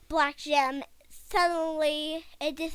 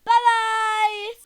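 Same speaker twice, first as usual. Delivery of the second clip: high, loud, gleeful sign-off; shouted goodbye; 7 semitones higher, 10 dB louder than usual. Real, not acted. Boy saying goodbye to podcast listeners, shouted. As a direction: call out the farewell at full volume.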